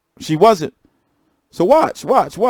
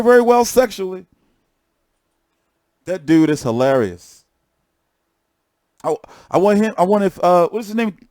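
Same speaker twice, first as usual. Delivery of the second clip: ordinary talk, nothing marked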